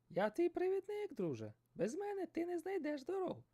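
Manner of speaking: high squawky seagull voice. Acted. Seagull teasing the fisherman, high and squawky.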